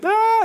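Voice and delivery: high loud imitated baby wail; mock infant scream; baby's scream. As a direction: shriek like a furious baby. Kid screaming till red.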